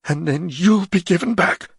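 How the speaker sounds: sharp. Acted. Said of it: "Ger said sharply".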